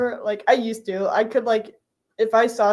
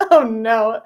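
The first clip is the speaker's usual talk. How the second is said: louder, dreading oh no; dreading groan; 7 dB louder than usual. Retold or imitated. She dreads the coworkers' teasing, louder groan. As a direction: brace for the teasing. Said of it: "I'm like".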